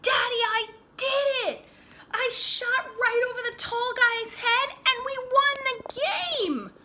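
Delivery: high, loud cry of triumph with a laugh; a boy shouting his win to his dad; joyful shouting. A narrator's reading. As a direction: burst in shouting the news. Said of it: "he shouted".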